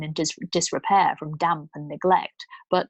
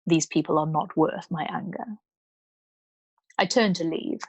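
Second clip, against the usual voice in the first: ordinary delivery, nothing marked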